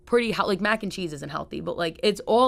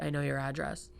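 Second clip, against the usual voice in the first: mock threat; 4 semitones lower, 9 dB quieter than usual. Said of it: "That's my new plan".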